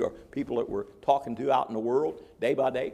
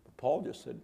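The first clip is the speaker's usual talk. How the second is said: ordinary talk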